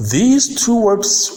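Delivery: ordinary talk, nothing marked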